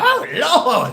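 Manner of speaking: high, loud scandalized cry; mock scream. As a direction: cry out to heaven in shock. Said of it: "scream out".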